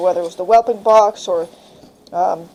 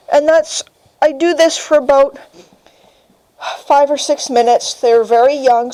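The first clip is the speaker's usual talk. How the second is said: ordinary talk, nothing marked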